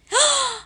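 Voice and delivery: high, sharp gasp of dismay; high acted gasp; horrified gasp. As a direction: gasp in sudden realization. Piglet realizes balloon burst.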